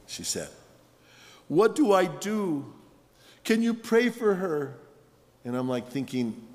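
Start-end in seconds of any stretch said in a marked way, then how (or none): 1.5-4.8 higher, pleading retold cry; a touch of desperation, imitating a tearful caller; imitating distraught mother. Bereaved mother phoning her pastor for prayer, retold tearful plea. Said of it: "she's bawling. She's crying".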